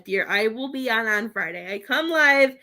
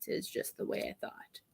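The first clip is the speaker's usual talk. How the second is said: whispered aside; breathy whisper to herself; 16 dB quieter than usual. Real, not acted.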